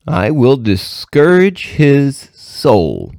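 high, very slow, deliberate pronouncement; imp's declaration. A narrator's reading. Imp revealing his plan to Satan, slow declaration. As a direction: draw out each word as a momentous reveal.

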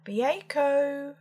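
high baker's street call; high, carrying vendor's cry. A narrator's reading. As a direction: sing it out like a street seller. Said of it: "calling out".

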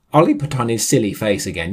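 ordinary delivery

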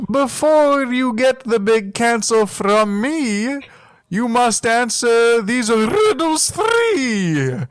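high, slow theatrical gatekeeper; riddle keeper voice. Pretend. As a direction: intone it like a mystical riddler. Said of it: "is what I would say".

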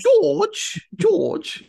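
high, loud cartoon piglet calling George; mock cartoon voice of a piglet; Peppa Pig voice. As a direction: squeal the name like a little Peppa Pig character. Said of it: "whose children watch Peppa Pig".

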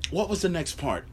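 ordinary delivery

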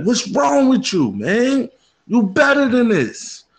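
high-pitched exasperated self-scolding; yelling at himself. Retold or imitated. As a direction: reenact yelling at your own reflection, pitch way up. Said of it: "I was yelling at myself".

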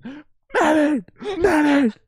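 high, loud, panicked cries for a medic; hammed-up war casualty yelling; wounded soldier. Mock wounded soldier calling for medic.

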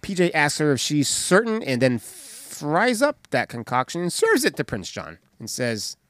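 ordinary delivery

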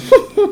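sudden high squeal of a laugh; squealing laugh at the story; high laugh burst. Real, not acted. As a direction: let out a high yelp of laughter. Big laugh.

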